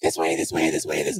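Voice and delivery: echoing insect swarm. Acted. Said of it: "growing in number".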